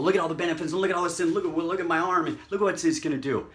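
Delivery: hammed-up hard sell; pushy amateur seller. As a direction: mimic an over-eager salesperson piling on benefits. Mimics amateurs selling hard.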